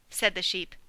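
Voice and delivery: usual speaking voice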